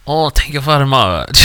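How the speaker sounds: ordinary talk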